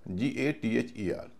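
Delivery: slow, deliberate letter-by-letter spelling. Shown as a demonstration. Teacher slowly spells gather for students. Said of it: "या gather".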